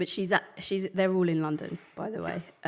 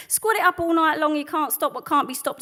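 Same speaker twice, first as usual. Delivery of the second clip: raised high and loud in defiance; defiant outcry; 9 semitones higher, 10 dB louder than usual. Acted. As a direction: throw the line out at the crowd. Defiant peak of protest poem.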